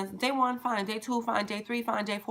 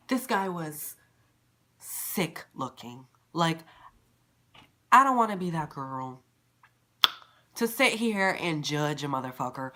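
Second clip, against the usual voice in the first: usual speaking voice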